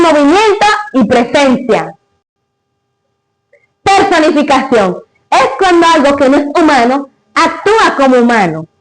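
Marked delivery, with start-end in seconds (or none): none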